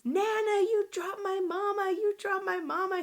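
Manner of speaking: high-pitched imitated little kid; screaming child. Child accusing nana of dropping mama, retold scream. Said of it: "My baby screams".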